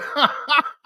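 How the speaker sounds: a short high burst of real laughter. Real, not acted. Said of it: "Now that's funny".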